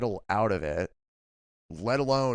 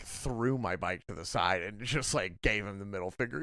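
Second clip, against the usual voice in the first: ordinary talk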